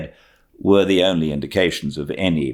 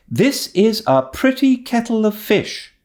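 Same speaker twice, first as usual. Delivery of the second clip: much higher, sharp exasperated outburst; wrathful. Acted. Marilla fuming to Matthew about the mix-up, sharp and high. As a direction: let the irritation burst out. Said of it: "she said wrathfully".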